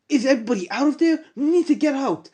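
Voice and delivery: high, fast calling out; urgent shouting. Acted. Hero calls to the others, high fast urgent shout. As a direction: shout quickly to the group.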